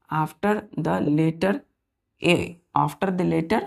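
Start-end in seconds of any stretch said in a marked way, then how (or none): 0.1-2.4 put-on teacher reading a rule slowly for children; slow dictation. Shown as a demonstration. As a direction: say after the letter very slowly, word by word. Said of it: "इसमें क्या बोला है कि if".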